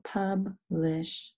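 publish drawn out as "pub", pause, "lish"; split into syllables. Shown as a demonstration. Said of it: "thinking about the syllables".

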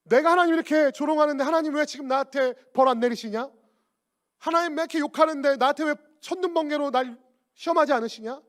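loud, taunting challenge to God; mocking challenge. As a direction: hurl it as a scoffer's jeering question. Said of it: "그리스도인들에게 이렇게 조롱합니다".

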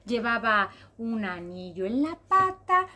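usual speaking voice

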